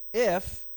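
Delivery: IF thrown back hard and loud; a sharp, loud "If," to open the retort; stress on if. Retold or imitated. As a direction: slam the word "If," back at him. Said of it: "throws the if word right back at him".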